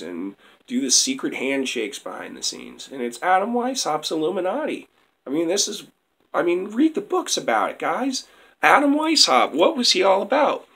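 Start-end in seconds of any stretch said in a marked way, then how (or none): 2.9-10.7 high, strained hoarse voice. Real, not acted. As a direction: push a worn-out voice up high. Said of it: "I'm losing my voice".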